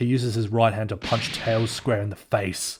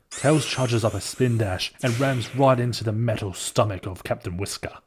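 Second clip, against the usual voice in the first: ordinary delivery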